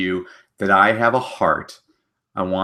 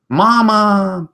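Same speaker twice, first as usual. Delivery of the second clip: high, louder longing call; baby hippo; 13 semitones higher, 6 dB louder than usual. Acted. Baby hippo calling the tortoise mama, high and louder. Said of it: "baby hippo ... saying".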